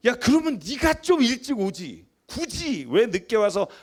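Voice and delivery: mock the irritated dad snapping back; father yelling back. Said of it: "소리를 질러요".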